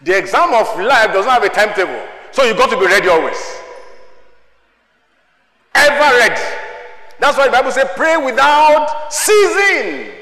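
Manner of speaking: rally the church to readiness; building exhortation. Real, not acted. Preacher urging the congregation to be ever ready, loud.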